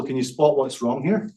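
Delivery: usual speaking voice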